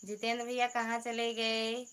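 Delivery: ordinary delivery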